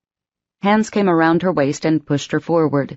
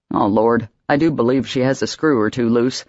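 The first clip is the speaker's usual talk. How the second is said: a lightly voiced old man teasing; Patrick, amused; 4 semitones lower than usual. Old Patrick joking to Emma about Missus Forrester, amused. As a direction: lower voice of an old man, chuckling. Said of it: "He chuckled".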